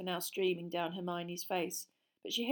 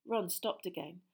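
plain reading voice

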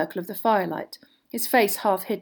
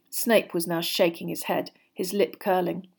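ordinary delivery

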